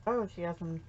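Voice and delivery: ordinary talk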